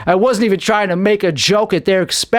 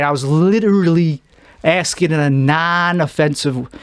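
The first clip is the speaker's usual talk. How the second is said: ordinary talk, nothing marked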